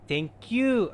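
sweet high-pitched thanks; high cute thank you. Shown as a demonstration.

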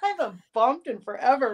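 high, laughing "the bump?"; delighted surprise. Retold or imitated. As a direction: squeal it out, tickled and surprised. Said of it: "and I'm like".

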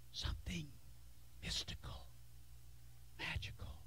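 eerie hushed whisper; mock mystical whisper. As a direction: whisper it like a spooky secret. Mocking mystical notion of church.

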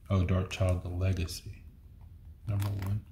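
usual speaking voice

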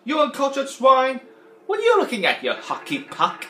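loud, high mock Potato Head insults; Mister Potato Head impression. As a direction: belt it out as a cranky toy. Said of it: "I sound a bit like Don Rickles when I do Mister Potato Head".

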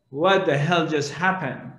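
ordinary delivery

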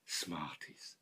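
"Smarties" breathed in a low whisper. Acted. Old man whispering "Smarties" to himself, dreamy. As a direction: whisper "Smarties" to yourself, savouring it.

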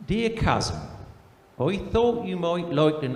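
put-on broad country accent, letter read aloud; put-on rural accent, comic storytelling. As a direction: read it as a rustic cousin with a rural English accent. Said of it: "best read in a rural accent".

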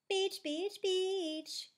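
high gleeful chant; excited yell. A narrator's reading. Plastic too excited to listen, high chanting yell. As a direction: chant it out high like an overexcited child. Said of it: "yells Plastic again".

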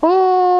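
high-pitched droning "oh"; imitated drone noise. Explaining why drone has no audio, imitated high drone noise. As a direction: mimic a whining drone up high. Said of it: "all you hear is".

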